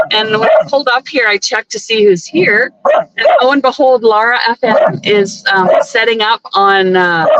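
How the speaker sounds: usual speaking voice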